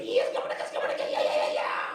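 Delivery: raspy excited gibbering shout; wild little gremlin hype voice; Donnie gibberish voice. Retold or imitated. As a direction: babble it like a wild feral kid, loud. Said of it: "My voices sound like Donnie from the Wild Thornberrys".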